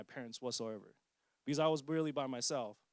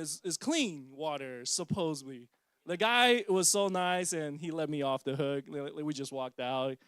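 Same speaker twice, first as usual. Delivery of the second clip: normal talking voice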